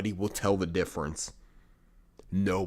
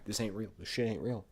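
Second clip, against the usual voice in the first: ordinary delivery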